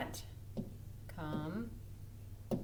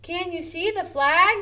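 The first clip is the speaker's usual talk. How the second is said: lively question; 8 semitones higher, 7 dB louder than usual. Shown as a demonstration. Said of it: "this should be read".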